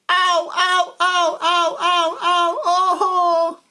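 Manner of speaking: loud, high wails of pain; crying out in pain. A narrator's reading. Man clinging to the thorn bush howls in pain. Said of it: "And as he cries".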